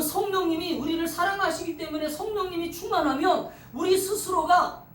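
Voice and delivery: normal talking voice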